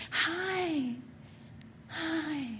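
a put-on nice hello over the grudge; mock friendly hi; fake sweet greeting. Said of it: "And inside you're like".

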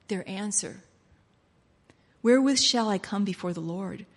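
ordinary talk, nothing marked

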